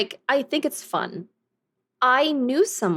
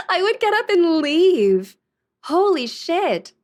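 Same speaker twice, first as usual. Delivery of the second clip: laughing through the words, higher and louder; 7 semitones higher, 5 dB louder, slower than usual. Real, not acted. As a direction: say it again while cracking up.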